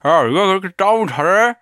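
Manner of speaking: slurred mock numb mouth; numb from the dentist. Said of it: "이게 아프니까".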